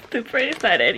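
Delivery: ordinary talk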